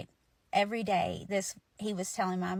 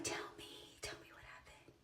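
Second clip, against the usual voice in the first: very soft, voiceless pleading whisper; eager whisper; 19 dB quieter than usual. Retold or imitated. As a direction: whisper back, begging for the news. Answers mom's whisper in whispers.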